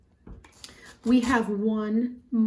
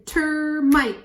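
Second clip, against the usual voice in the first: "Termite" in two beats, first one stretched, higher and louder; syllable by syllable; 5 semitones higher, 5 dB louder than usual. Shown as a demonstration. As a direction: sound out "Termite" emphatically by syllable. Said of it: "Two syllables".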